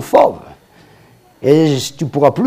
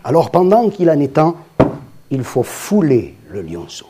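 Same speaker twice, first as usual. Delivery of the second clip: ordinary talk, nothing marked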